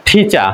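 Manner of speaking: "ঠিচা" with puffed t, no final r, falling tune; British pronunciation. Shown as a demonstration. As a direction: model "ঠিচা" alone with aspirated t and open ending. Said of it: "কি উচ্চারণ হবে". ("ঠিচা" মহাপ্রাণ ঠ, র ছাড়া)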